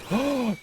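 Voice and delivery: a sharp, high mock gasp of delight; imitated excited gasp. Girlfriend's gasp at the dinner surprise, sharp and high.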